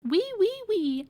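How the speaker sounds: piglet answer. Acted. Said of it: "answered Robinson".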